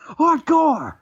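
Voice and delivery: high, loud "Hardcore!" yell; shouted catchphrase. Acted. Host yells the wrestler's catchphrase to cohost, loud. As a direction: belt it out like a ring entrance.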